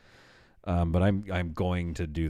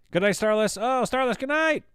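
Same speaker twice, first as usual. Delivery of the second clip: much higher, louder, sing-song farewell; sing-song goodnight; 5 dB louder than usual. Real, not acted. Streamer calling goodnight to a departing viewer, high sing-song. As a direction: lilt a playful goodnight up high.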